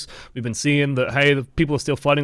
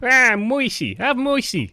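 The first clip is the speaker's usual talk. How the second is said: high, louder hammed-up New York gangster accent; 10 semitones higher, 3 dB louder, slower than usual. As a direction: call out to him like a New York mobster. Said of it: "like, gangster movie".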